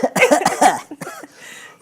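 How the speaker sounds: loud delighted laugh; burst of laughter. Real, not acted. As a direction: crack up laughing. Host cracks up at helium voice.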